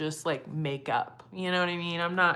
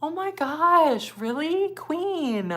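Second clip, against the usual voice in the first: much higher, louder, slow gasp of delight; overjoyed; 13 semitones higher, 6 dB louder, much slower than usual. Real, not acted. Creator overjoyed at a viewer's kind comment. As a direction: squeal with touched disbelief. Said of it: "Oh my God, I'm gonna cry".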